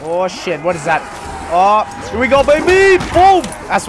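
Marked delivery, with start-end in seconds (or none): 1.5-3.4 high, loud celebratory yell; hype shout. Real, not acted. Gamer hyping his big hit, shouting with joy. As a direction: cheer the kill out loud.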